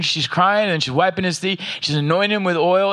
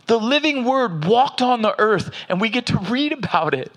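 preaching; 5 semitones higher than usual. Acted. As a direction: lift it like a pulpit build, slowing on the key words. Preaching build about the Word.

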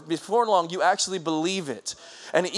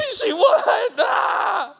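laughing, much higher and louder whoop; ecstatic cheer; 6 dB louder, much slower than usual. Real, not acted. Watching the film with Hunter, an ecstatic high cheer. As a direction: celebrate the win at the top of your voice. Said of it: "high-fiving each other".

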